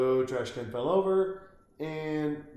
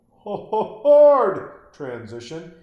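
hard transition stretched slow and much louder; Ho, ho, hard transition! hammed up, slow and louder; mock exclaim; 4 semitones higher, 12 dB louder, much slower than usual. Shown as a demonstration. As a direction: belt hard transition like a big mock cry. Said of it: "that's a hard transition".

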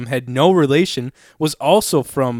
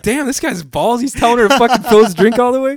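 excited; 10 semitones higher, 6 dB louder than usual. Retold or imitated. Excited retold amazement.

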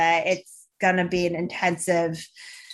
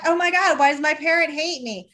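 higher, louder whine; whiny owner; 6 semitones higher, 3 dB louder than usual. Retold or imitated. Owner asking why the pet hates them, mock whiny. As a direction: complain like a hurt pet owner. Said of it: "everyone's like".

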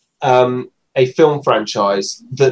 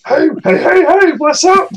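high goofy voice; 4 dB louder than usual. Retold or imitated. Mock voice of a stranger.

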